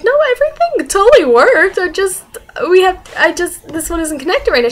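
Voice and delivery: defensive protest. Retold or imitated. Student assuring the teacher the lab worked, defensive. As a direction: act out a student hastily defending herself.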